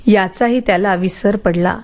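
ordinary delivery

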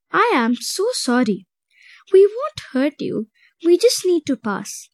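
plain reading voice

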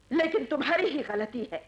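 fast, loud reproach; heated accusation. Acted. Woman blaming companion for rushing downstairs, accusing. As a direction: pile on the blame quickly. Blames him for their trouble.

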